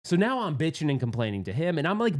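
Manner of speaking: usual speaking voice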